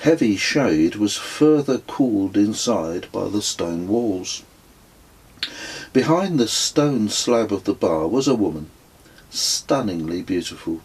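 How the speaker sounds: ordinary delivery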